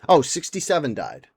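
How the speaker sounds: ordinary talk, nothing marked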